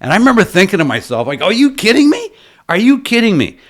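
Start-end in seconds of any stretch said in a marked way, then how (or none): 1.4-3.6 reenacted disbelief, pitched sharply higher; incredulous. Guest thinking to himself about the porter's offer, incredulous. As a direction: recall being floored, voice leaping up. Recalls his amazed thought at porter.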